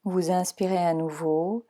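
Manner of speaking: calm, even meditation voice guiding relaxation; guided meditation. Acted. Leads Yoga Nidra relaxation.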